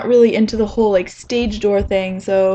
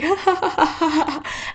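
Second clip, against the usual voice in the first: imitated laughter; 7 semitones higher, slower than usual. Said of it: "He started laughing".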